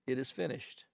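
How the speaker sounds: quiet, resigned last words. Retold or imitated. Patient's last words, quiet and resigned. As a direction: let it sink out softly in defeat. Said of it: "those words become words of resignation".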